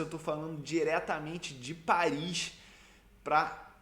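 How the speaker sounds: usual speaking voice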